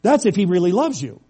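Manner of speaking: usual speaking voice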